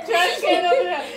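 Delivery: high, loud, slow laughter; cracking up hysterically; laughing hard. Real, not acted. Laughing hard at gross flavor.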